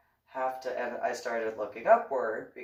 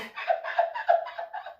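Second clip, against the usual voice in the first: high shrieking laugh fit; helpless laughter. Real, not acted. Cracking up over "domly", helpless high laugh. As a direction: lose it completely laughing.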